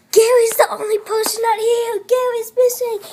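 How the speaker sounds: high, loud play-acted alarm; alarmed realisation. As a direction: cry out the shocking discovery, high and held.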